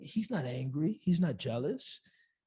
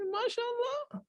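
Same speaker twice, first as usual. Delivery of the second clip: high, slow, drawn-out mashallah; mock congratulation; 9 dB quieter, slower than usual. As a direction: overdo the polite blessing. Mock congratulating [name]'s wealth.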